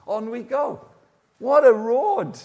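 ordinary talk

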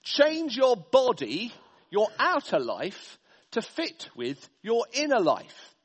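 drawn out, each word stretched, starting loud and high; slow mocking quote. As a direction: spell out the culture's slogan slowly and mockingly. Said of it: "Our culture says".

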